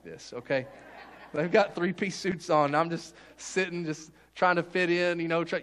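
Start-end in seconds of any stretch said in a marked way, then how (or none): none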